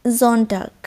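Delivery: জনটাক said slowly with a z onset; German Sonntag. Shown as a demonstration. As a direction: give জনটাক its German z sound. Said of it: "জনটাক মানে হচ্ছে Sunday". (German উচ্চারণে জনটাক)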